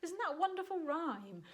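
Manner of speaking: usual speaking voice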